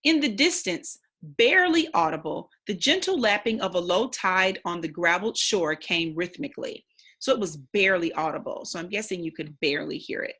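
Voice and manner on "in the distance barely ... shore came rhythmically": steady, expressive read-aloud narration; story narration. Acted. Teacher reading the novel to the class, storytelling narration. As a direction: read the chapter aloud to young listeners. Rereading the passage.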